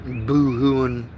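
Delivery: ordinary delivery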